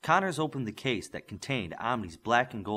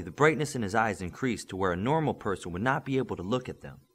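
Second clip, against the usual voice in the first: usual speaking voice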